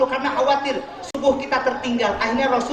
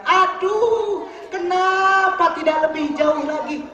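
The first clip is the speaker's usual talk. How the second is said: high, drawn-out mock wail; imitated lament; 6 semitones higher, slower than usual. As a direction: stretch it out like a dying man's regret. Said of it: "maka Sa'ban bilang".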